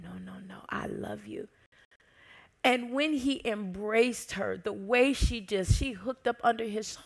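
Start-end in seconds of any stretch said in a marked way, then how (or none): 0.0-1.5 soft whispered reassurance; tender whisper. Retold or imitated. Jesus reassuring someone who feels unworthy, tender whisper. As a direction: hush them gently with love.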